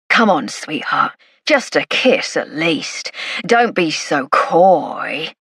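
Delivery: put-on slow, slurring drunk pleading; drunk man. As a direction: drag the words out like a tipsy masher. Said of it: "leaning drunkenly".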